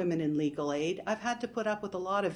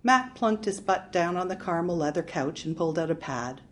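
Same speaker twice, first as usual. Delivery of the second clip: usual speaking voice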